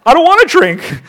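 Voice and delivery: very high, loud mock protest; protesting sponsee. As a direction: squeal the refusal in imitation.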